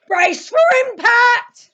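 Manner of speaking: high, loud warning yell; commanding shout; urgent shout. Acted. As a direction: bellow the alarm before the crash. Warning before the crash.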